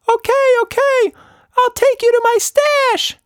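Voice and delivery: very high, loud squeak; a cornered mouse giving in; squeaky voice. A narrator's reading. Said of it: "he squeaked".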